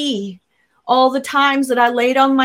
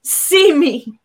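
higher, louder cry out to God; reliving a desperate, raised-voice plea; anguished yell; 5 semitones higher, 4 dB louder than usual. Retold or imitated. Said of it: "I yelled out at God".

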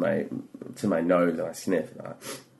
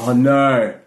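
retold panic; 5 semitones higher, 7 dB louder than usual. Acting out panic at being stuck.

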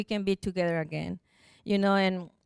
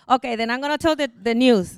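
louder, quick retold announcement; happy caller; 3 semitones higher, 6 dB louder than usual. Helper about to announce the permit, imitated happy excitement. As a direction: imitate her bursting with good news. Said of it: "I feel the happiness in her voice".

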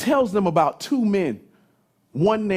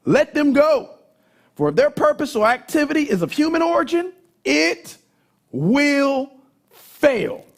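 high, ringing preacher's declaration; emphatic command; 11 semitones higher, 5 dB louder than usual. Acted. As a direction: proclaim it high, with weighty pauses. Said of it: "He was emphatic about it".

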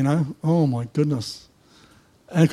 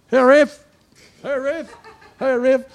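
calling out; 8 semitones higher than usual. Retold or imitated. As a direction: toss the nickname out cheerfully. Workers calling out on site.

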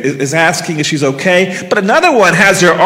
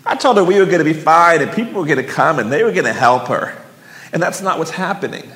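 ordinary delivery

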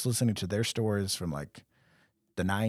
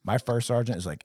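usual speaking voice

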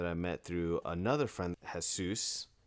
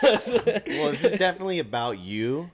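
laughing; 9 semitones higher, 10 dB louder than usual. Real, not acted. Laughing reply.

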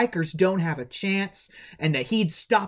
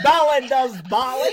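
higher, louder put-on British film accent, laughing; imitated film accent; 7 semitones higher, 5 dB louder than usual. Echoing co-host about Billy's ballet, imitated film accent. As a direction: mimic the film's British accent through laughter. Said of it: "I just love this accent".

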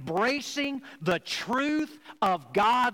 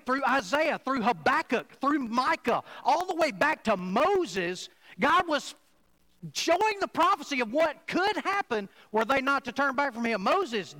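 full-force preaching; 6 semitones higher than usual. Acted. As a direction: preach it out to the congregation. Preacher builds intensity on prophets.

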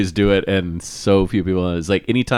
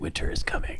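hushed, mock-ominous warning; ominous whisper; 13 dB quieter than usual. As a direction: whisper it like a portent. Quotes ominous catchphrase as joke.

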